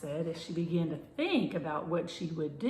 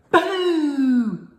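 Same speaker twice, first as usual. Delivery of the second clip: loud, high ghostly boo; 10 semitones higher, 15 dB louder than usual. A narrator's reading. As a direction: blast it out to scare. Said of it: "she said very loudly".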